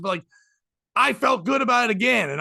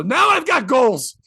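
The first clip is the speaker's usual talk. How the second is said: louder, slow triumphant announcement; proud punchline; 5 semitones higher, 7 dB louder, slower than usual. Real, not acted. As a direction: deliver it slowly, like a proud boast. Punchline about the pill.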